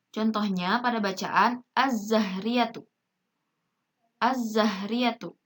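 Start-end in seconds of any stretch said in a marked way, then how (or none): 1.7-2.8 slow "az-zahriyatu" with a long Z; silent lam. Shown as a demonstration. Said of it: "Alif lamnya itu tidak dibaca jelas". 4.2-5.4 slow "az-zahriyatu" with a long Z; silent lam. Shown as a demonstration. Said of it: "Alif lamnya itu tidak dibaca jelas".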